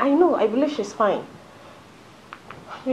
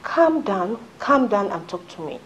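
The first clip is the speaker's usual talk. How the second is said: ordinary delivery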